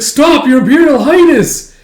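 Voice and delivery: high, loud shout of protest; desperate plea. Acted. Captive begging the emperor to stop, desperate shout. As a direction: cry out to halt the emperor.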